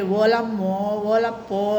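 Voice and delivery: slow, drawn-out coastal Uttarandhra cry; a light imitation of coastal Uttarandhra speech; coastal fisherfolk exclamation. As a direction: stretch out olammo olappo like the seaside folk do. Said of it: "అని మాట్లాడతారండి".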